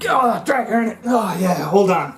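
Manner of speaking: ordinary talk, nothing marked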